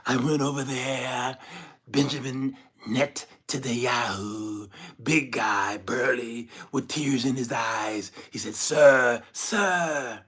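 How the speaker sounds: slow, drawn-out phrases with pauses; comic presidential impression, lingering on each boast; Donald Trump. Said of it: "do my little Trump impression".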